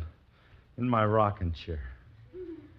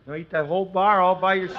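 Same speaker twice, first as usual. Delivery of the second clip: squeaky ventriloquist dummy; 9 semitones higher, 7 dB louder, faster than usual. Acted. Dummy voice asks kid.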